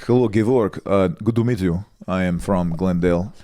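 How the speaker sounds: put-on Armenian accent, polite introduction. Boyfriend introducing himself to girlfriend's dad, Armenian accent. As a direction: introduce yourself as an Armenian guy. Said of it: "you do a fantastic Armenian accent".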